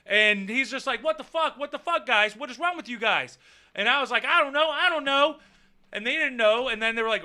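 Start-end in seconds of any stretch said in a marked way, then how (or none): 1.0-3.4 lightly voiced exasperated teacher, pitched up; high, baffled mock scolding. Voices teacher breaking up the fight. 4.2-5.4 high, flustered retold answer; high-pitched mock helplessness. Acts out own answer to teacher.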